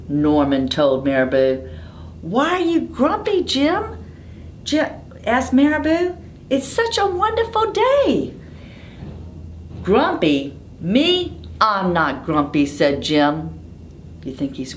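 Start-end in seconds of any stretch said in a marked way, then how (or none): 2.2-8.3 high, sing-song bird voice; a cheerful high bird asking Jim; picture-book bird, bright and high; Marabou voice. Acted. 9.8-12.7 squeaky on me, then denying; a grumpy monkey insisting he's fine; indignant Jim. Acted. Jim protests in story.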